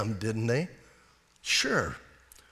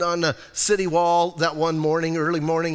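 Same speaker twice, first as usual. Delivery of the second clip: usual speaking voice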